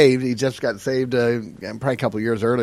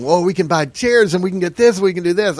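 higher, louder, carried away; retold burst of eager excitement; giddy planning; 6 semitones higher, 5 dB louder than usual. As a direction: rattle off the wish list excitedly. Retells excited spending daydream.

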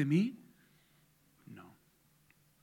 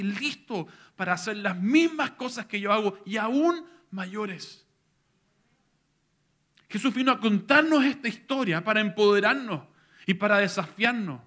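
higher and much louder, climactic sermon; full-force preaching; 8 semitones higher, 9 dB louder than usual. Acted. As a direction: build to a passionate crescendo.

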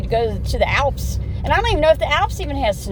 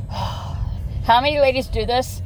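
ordinary talk, nothing marked

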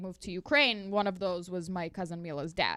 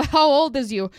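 much louder, higher stage call-out; loud retold question; 6 semitones higher, 12 dB louder than usual. Host quizzing a comic on stage, loud retold call-out.

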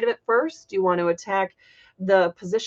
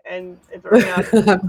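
stifled giggles; 7 dB louder than usual. Real, not acted. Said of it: "I was trying not to laugh".

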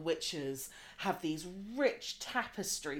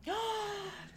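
sharp gasp; 4 dB quieter than usual. Real, not acted. Reacts to friend's words.

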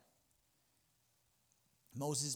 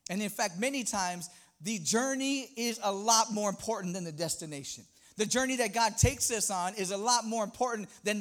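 raised, loud preacher drive; fervent preaching; 6 semitones higher, 6 dB louder than usual. Acted. Pastor urging congregation, full preaching voice. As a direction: preach it with rising force.

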